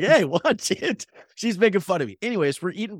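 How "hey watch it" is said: high, slow, playfully wounded hey watch it; mock offended protest. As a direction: act hurt in fun, drawn out. Mock protest at teasing waitress.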